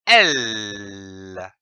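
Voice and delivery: a held, clear L; isolated L sound, tongue to the palate. Shown as a demonstration. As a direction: sound out the letter L slowly. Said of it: "en prononçant le son".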